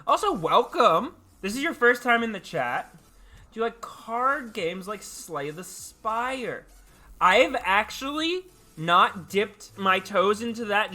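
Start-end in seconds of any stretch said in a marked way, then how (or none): none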